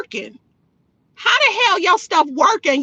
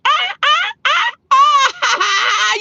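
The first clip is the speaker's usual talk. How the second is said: high staccato "ah" bursts into laughter; gleeful, high-pitched taunting laugh; mocking cackle; 9 semitones higher, much slower than usual. Real, not acted. Mocks his claim about forty-year-olds, cackling high.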